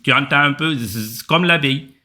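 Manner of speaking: normal talking voice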